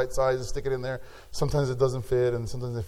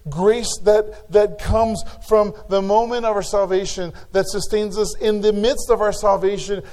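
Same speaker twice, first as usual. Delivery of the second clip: raised, ringing sermon voice; a pastor urging the church to use their gifts, preaching hard; full-force preaching; 6 semitones higher, 5 dB louder than usual. Acted. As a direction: preach it out to the congregation. Reads scripture, then preaches on grace.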